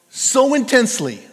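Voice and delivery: "so" high and loud; "so intensely" with a big push on "so"; stress on so. Shown as a demonstration. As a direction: hit "so" hard. Preacher emphasis when dictating notes.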